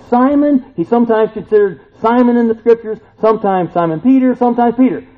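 a fired-up pastor driving the point home; full-force preaching. Acted. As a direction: preach it out to the back pews.

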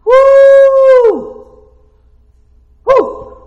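high, loud woo; joyful whoop. Acted. Preacher whooping to show the creatures' excitement, loud. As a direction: whoop for joy. Shouted joy in sermon.

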